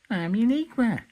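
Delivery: chirpy character voice. Acted. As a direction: pitch it way up to greet everyone. Character repeats her name as answer.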